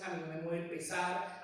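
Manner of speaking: usual speaking voice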